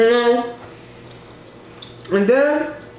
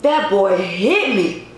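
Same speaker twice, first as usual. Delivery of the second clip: normal talking voice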